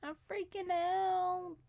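"I'm freaking out" high, soft and drawn out; a small, high, stretched "I'm freaking out"; high-pitched freak-out. Pretend. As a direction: squeak out "freaking out" slowly and softly. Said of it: "How many times am I gonna say I'm freaking out".

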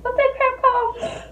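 high frustrated squeal; exasperated high voice. Real, not acted. Can't recall the word.

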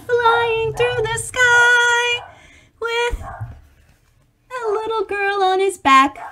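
high, slow, soaring voice; high drawn-out awe; dreamy wonder. Acted. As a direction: float it up like flying. Dragon flying with girl.

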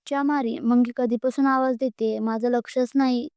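plain reading voice

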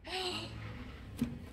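long airy gasp of delight; real gasp of delight. Real, not acted. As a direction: gasp at something adorable. Sees umbrella bow.

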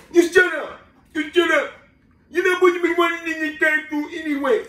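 high, garbled mock scolding; imitated patrol kid. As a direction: talk back in a put-on kid voice. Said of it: "He said".

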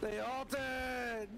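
high-pitched cry of complaint; high, stretched-out blame; high wail. Real, not acted. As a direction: wail at the teammate, high and drawn out. Wailing at teammate after dying.

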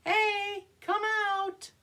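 high, bright call to a shy friend; calling to the puppet mouse; coaxing call. Shown as a demonstration. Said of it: "Can you say it with me".

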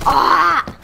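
loud sudden shout; frustrated yell. Real, not acted. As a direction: cry out when shot. Killed in game.

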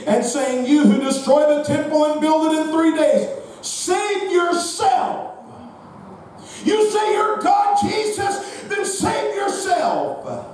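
high preached taunt, slowing on the last words; full-force preaching. Real, not acted. Preacher voicing the mockers at the cross, high drawn-out taunt. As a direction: stretch out the challenge in a high preacher's cry.

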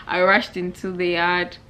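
ordinary talk, nothing marked

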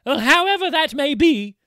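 high, loud pleading voice; frightened young bride. Acted. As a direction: beg him away in alarm. Undine answers her uncle.

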